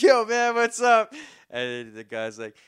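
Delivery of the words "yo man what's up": put-on drunk greeting. Drunk greeting a stranger in jail.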